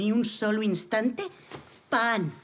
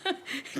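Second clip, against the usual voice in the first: short scoffing laugh; 12 semitones higher, 5 dB quieter than usual. Acted. Scoffs at timid revolutionaries.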